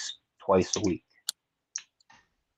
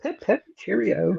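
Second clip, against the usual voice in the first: mock posh English; 10 semitones higher, 6 dB quieter than usual. Mock English toff phrase.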